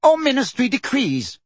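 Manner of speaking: high-pitched, indignant correction; exasperated outburst without extra volume; angry. A narrator's reading. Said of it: "amended Fudge angrily".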